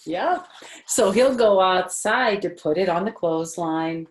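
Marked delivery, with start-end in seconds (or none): none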